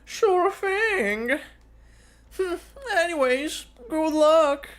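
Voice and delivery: high, sly schemer's voice; high, teasing villain coaxing an ally; scheming W. Acted. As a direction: coax him like a sly mercenary.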